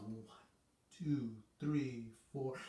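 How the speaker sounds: softly counted beats; hushed one two three four; soft counting. Shown as a demonstration. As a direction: count it quietly. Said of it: "nice and loud or really soft".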